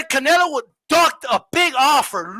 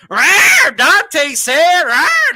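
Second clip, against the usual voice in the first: higher, louder mock parrot squawk; 4 semitones higher, 6 dB louder, much slower than usual. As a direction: squawk it like a parrot repeating its master. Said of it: "If you wanna be a parrot".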